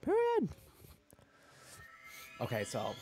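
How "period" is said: squeaky high. Acted. Squealed catchphrase.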